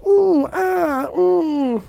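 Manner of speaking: high, mock-awed ooh and ah; mocking crowd ooh. Podcaster mimicking fireworks crowd, high mock awe. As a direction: imitate a dazzled crowd.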